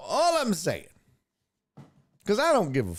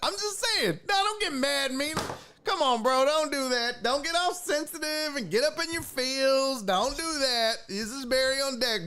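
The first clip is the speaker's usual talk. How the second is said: much higher, mocking scold; high mocking tease; 9 semitones higher than usual. Real, not acted. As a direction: needle Mina in a high sing-song scold. Teasing Mina, high mock voice.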